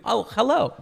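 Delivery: high, loud cheery phone greeting; receptionist answering. Pretend. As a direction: pick up like a friendly clerk. Plays the club answering.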